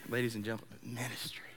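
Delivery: hushed whisper for the reveal. Real, not acted. Telling the audience the other M word, whispered. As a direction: lean in and whisper it.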